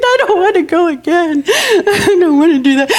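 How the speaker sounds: put-on high, whimpering little-kid plea; acted childish dread; reluctant child. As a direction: whine it to yourself in dread. Reluctant child's inner thought, acted.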